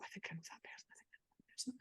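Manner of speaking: barely audible private whisper; whispered thought. Retold or imitated. Thinking to herself about a half-recognised person, whispered. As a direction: whisper it to yourself.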